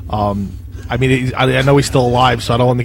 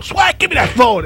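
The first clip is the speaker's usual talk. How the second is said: higher, slow grab for the phone in mock anger; mock angry boss; 8 semitones higher, 3 dB louder, slower than usual. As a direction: imitate the furious pizza boss. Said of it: "And he'd go".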